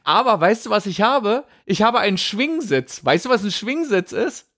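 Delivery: hoch und lebhaft vor Begeisterung; erzähl es voller Vorfreude; begeistert. Real, not acted. Schwärmt begeistert vom Schwingsitz. (ganz aufgeregt und hoch)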